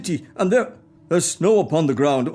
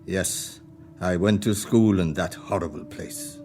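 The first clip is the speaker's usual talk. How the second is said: wistful memory; 11 semitones lower, 4 dB quieter than usual. Acted. Remembers school as a boy.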